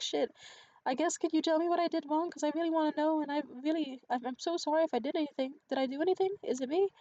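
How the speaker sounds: high, loud anxious pleading, mimicked; imitating panic. Date asking what he did wrong, imitated as panicking. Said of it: "he started panicking again".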